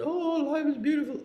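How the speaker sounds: high, fast, blissed-out sigh of a guru. Retold or imitated. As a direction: float it up like a guru with eyes rolled back. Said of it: "so blissed out like Sri Chinmoy".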